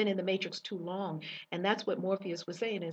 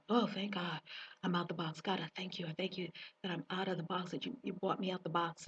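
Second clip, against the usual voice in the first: quiet prayer; 8 dB quieter, faster than usual. Retold or imitated. Retelling her prayer walking.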